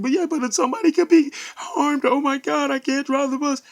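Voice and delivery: near-hysterical high pleading; panicked babbling. Retold or imitated. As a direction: blurt it out in a panic. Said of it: "while I'm babbling, almost incoherent".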